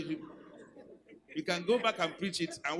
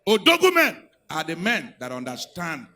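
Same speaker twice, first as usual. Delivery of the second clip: preaching at full force; 14 dB louder, slower than usual. Real, not acted. Pastor challenging husbands, shouted preaching. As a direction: thunder it at the men.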